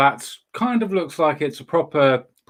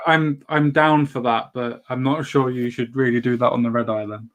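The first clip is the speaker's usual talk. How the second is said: usual speaking voice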